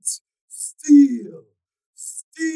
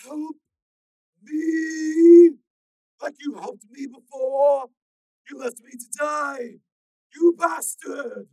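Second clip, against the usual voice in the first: high, slow loud growl; strained warning; 19 dB louder, much slower than usual. A narrator's reading. Stalker warning Steel, slow and strained. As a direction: hold him back, slowly.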